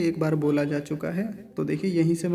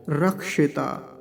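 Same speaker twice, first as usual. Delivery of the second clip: रक्षिता said slowly with a crisp क्ष; slow model; much slower than usual. Shown as a demonstration. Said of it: "देखिए". (रक्षिता धीरे स्पष्ट)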